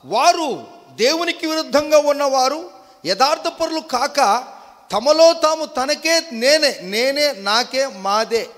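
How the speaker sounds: high, loud verse declamation; full-force preaching. Real, not acted. (గట్టిగా, ఉద్వేగంగా వాక్య ప్రసంగం)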